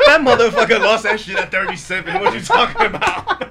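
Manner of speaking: loud, high, laughing disbelief. Real, not acted. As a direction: shout it while cracking up. Laughing ribbing about hair.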